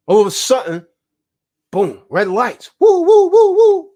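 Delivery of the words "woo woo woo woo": high, loud siren wail woo woo; much higher, louder siren noise; siren imitation. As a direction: mimic a police siren wailing.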